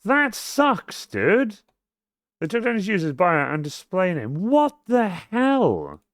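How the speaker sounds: high, whiny protest; exasperated disbelief. Real, not acted. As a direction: complain in high-pitched bafflement. Still protesting at the failing command.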